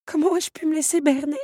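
high-pitched shocked dismay; choked dismay. A narrator's reading. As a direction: exclaim high, aghast. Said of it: "s'étouffa Belle". (consternation étranglée, voix aiguë)

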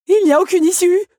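high, loud panicked cry. A narrator's reading. Otto finds no way out of the room, crying in panic. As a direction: cry out as if trapped. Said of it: "paniqua Otto".

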